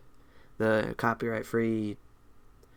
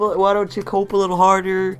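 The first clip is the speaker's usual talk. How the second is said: taunting; 6 semitones higher, 7 dB louder than usual. Pretend. Taunting the reader.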